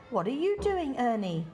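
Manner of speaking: ordinary delivery, nothing marked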